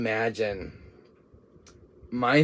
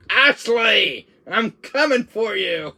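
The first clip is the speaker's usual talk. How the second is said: higher, louder heroic call; heroic Leon reply; 9 semitones higher, 6 dB louder, much slower than usual. Acted. Leon shouting back to Ashley, heroic. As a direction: call back like a rescuing hero.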